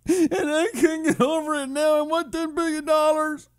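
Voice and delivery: high-pitched fake crying; mock tearful accuser. Imagined accuser demands a payout, mock sobbing. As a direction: wail it in an exaggerated sobbing falsetto.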